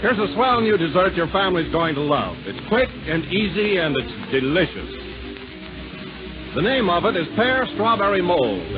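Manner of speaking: ordinary talk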